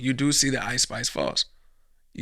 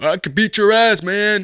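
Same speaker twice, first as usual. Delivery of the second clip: put-on loud, higher drunk challenge; mock drunk guy; 6 semitones higher, 8 dB louder than usual. Drunk guy challenging a UFC fighter, loud and higher. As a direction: swagger like a drunk. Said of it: "repeats drunk guy quote".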